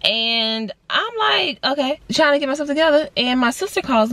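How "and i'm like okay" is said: normal talking voice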